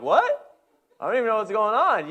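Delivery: sudden high, loud startled yelp; startled what. Retold or imitated. Dad with the baby, caught off guard, high startled outburst. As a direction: jump in confusion. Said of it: "I'm like".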